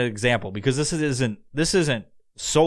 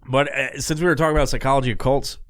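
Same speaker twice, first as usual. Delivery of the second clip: usual speaking voice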